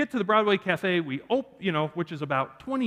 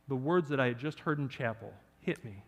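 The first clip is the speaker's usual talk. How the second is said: ordinary talk, nothing marked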